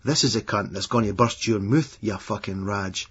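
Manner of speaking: ordinary delivery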